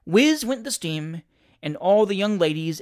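ordinary delivery, nothing marked

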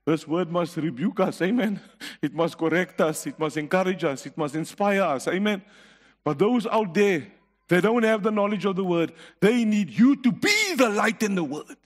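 rising exhortation to the church; stirring pulpit voice to the church; preaching. Acted. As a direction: call the congregation to action. Back to exhorting the congregation.

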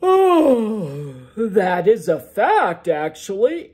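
loud ogre voice through a yawn; sleepy loud ogre yawning out the answer. Acted. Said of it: "in the middle of a yawn".

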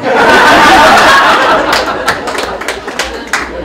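long breathy laugh, high and loud; long airy laugh. Real, not acted. Laughing at his mother's punchline, long airy laugh.

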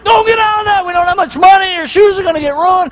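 put-on high, strained call to the kids; mock panicked dad shouting at the puddle; anxious parent yell. Dad calling his kids out of the mud, high and urgent. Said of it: "I was like".

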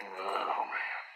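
ordinary delivery

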